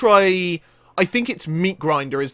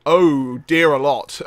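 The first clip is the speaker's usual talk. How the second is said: normal talking voice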